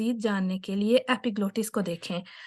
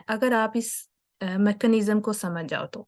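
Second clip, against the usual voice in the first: ordinary delivery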